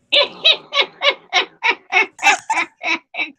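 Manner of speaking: put-on high wavering old woman's cackle; old lady cackle. Shown as a demonstration. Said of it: "and my laugh, I can do".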